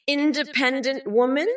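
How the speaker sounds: ordinary talk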